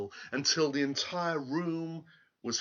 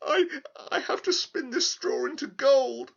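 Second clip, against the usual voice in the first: put-on high, louder, sobbing girl; tearful girl; 14 semitones higher, 5 dB louder than usual. Girl tells the little man her plight, sobbing high. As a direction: blurt it out through tears. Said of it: "she explained".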